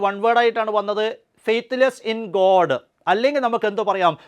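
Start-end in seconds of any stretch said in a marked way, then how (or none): 1.5-2.7 slow dictation. Shown as a demonstration. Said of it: "teacher gives meaning slowly".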